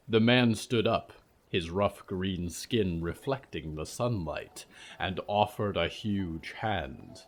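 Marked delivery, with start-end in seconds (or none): none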